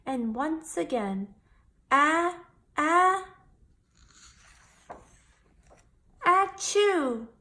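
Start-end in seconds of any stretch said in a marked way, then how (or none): 1.9-7.3 a high, loud building sneeze, ah-ah-ah then a burst; big sneeze. Acted. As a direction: wind up and explode into a sneeze. Feather sneeze makes Dumbo fly.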